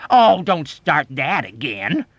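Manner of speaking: loud, high, drawn-out scorn; scornful, exasperated outburst; vitriolic scorn. A narrator's reading. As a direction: exclaim it with withering disgust. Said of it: "exclaimed with vitriolic scorn".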